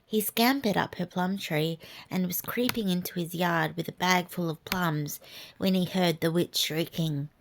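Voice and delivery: plain reading voice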